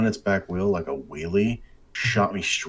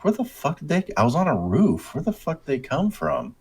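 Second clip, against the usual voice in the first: higher, stunned disbelief; shocked; 9 semitones higher than usual. Retold or imitated. Gamer on a roof, shocked the cops appeared. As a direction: blurt it out, baffled and indignant.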